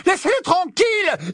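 high frantic scream; panicked high girl's scream; screaming plea. A narrator's reading. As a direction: shriek at them to stop. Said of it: "Hermione poussa un hurlement".

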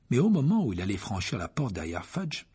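plain reading voice

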